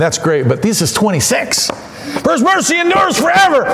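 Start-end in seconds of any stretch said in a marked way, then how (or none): 2.2-3.6 high, fervent refrain; exultant cry, pitched high; built-up emphasis. Shown as a demonstration. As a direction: lift it way up in a crescendo of praise. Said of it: "supposed to build and build and build".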